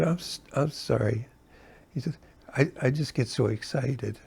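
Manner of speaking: soft, apologetic reply; sincere apology. Retold or imitated. Michael apologizing to Bill at the hotel door, soft and sincere. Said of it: "it was a sincere apology".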